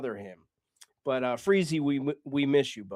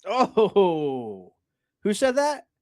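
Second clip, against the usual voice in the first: higher, louder "who said that?"; excited reaction; 6 semitones higher, 6 dB louder, much slower than usual. Real, not acted. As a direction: perk up and demand who said it.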